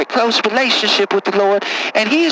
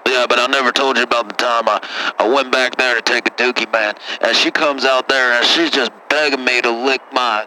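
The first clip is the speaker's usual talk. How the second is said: put-on much lower, rough-talking good ol' boy; deep caller voice; 11 semitones lower than usual. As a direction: play a low-voiced country caller. Same caller voice.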